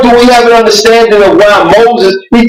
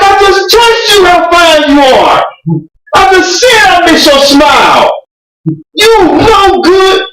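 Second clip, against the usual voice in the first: fuming; 11 semitones higher than usual. Retold or imitated. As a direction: vent like a jilted man, voice up. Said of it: "He fuming".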